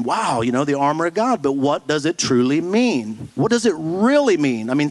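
usual speaking voice

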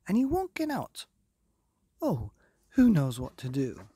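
high, loud fretful cry; alarmed page. Acted. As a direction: wail it in a panic.

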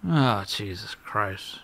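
low, slow, soft exasperated groan; weary groan. Real, not acted. Gamer stuck on a puzzle, muttering low and slow in despair. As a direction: drag it out, worn down by the puzzle.

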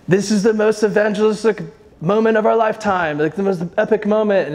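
a deliberately bad British accent, earnest and enthusiastic; a hint of a British pastor's voice; British accent impression. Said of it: "in a very British voice".

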